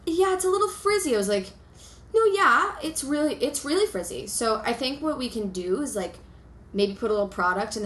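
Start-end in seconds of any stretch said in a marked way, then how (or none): 0.0-1.1 stylist's breezy verdict mimicked higher; mock stylist, higher. Retelling the salon visit, stylist's line mimicked higher. Quotes stylist judging her hair. 2.1-4.3 sarcastic agreement. Retold or imitated. Telling friends what she told the stylist, sarcastic and higher. Retells her own sarcastic reply.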